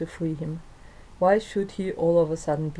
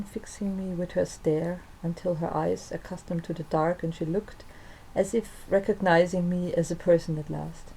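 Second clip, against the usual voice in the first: plain reading voice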